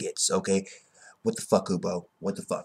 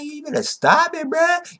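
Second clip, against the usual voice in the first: mock warning. Ichibei warning Juha.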